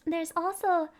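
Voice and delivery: normal talking voice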